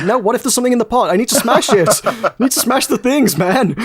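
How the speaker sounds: high, fast pleading to smash pots; mock whiny insistence. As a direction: beg like a kid who must smash everything. Insisting to the dev, whining.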